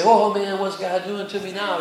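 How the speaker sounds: loud, worried outburst at the start; mock dismay. Preacher voicing a shopper suspicious of good fortune, mock dismay. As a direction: fret as if trouble is coming.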